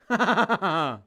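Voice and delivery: short burst of laughter. Real, not acted. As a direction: laugh out loud at your own joke. Laughs at his own quip.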